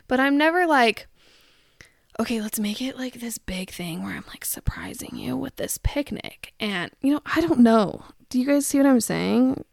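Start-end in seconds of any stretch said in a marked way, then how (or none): 2.2-6.5 softer, breathy mock whisper; whispered parody of a TikTok surprise. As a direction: hush it like a secret staged plan. Mocking a staged surprise post.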